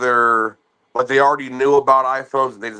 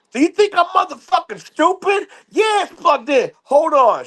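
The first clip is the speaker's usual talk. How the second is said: very high, outraged mock rant; mother insulted by the plug-in question, outraged; outraged customer; 13 semitones higher than usual. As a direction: act insulted at a dumb question. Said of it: "She goes".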